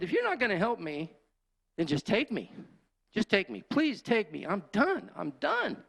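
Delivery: high-pitched, pleading surrender; worn-out man crying out to God; desperate yell. Retold or imitated. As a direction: beg to be taken, voice pushed up high. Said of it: "I just yelled out".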